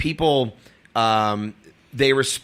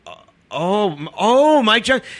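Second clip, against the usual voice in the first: high, stammered realization; acted dawning recognition; 9 semitones higher than usual. Comic recalls realizing who was wronged, acted high-pitched recognition. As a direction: stumble upward as the name sinks in. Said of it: "I'm like".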